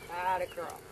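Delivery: usual speaking voice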